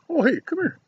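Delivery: high sing-song dog call. Real, not acted. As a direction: call the dog in a bright pet voice. Calling his dog over.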